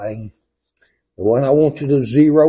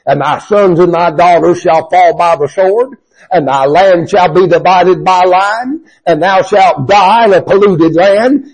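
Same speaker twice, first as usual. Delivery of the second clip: loud, building, higher denunciation; blistering judgment; 5 semitones higher, 8 dB louder than usual. Acted. Amos pronouncing doom on the priest, loud and rising. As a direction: blister him with every line. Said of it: "here's where he's gonna blister him".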